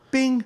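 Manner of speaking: high-pitched "bing"; short high ping; imitated phone alert. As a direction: chime like a notification.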